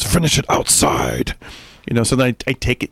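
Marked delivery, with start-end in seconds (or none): 0.0-1.3 low, slow, stern order; wife voiced low, sending him outside. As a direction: drop low and slow and lay down the law. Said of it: "she goes".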